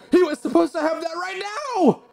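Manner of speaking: high, loud, eager fish warrior voice; excited Undyne. Acted. Undyne insisting the player take the cooking lesson, high loud eager warrior voice. Undyne's lines read in character.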